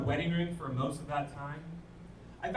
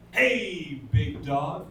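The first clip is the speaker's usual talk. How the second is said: hearty greeting; 8 dB louder, slower than usual. Retold or imitated. Greeting someone whose name he forgot.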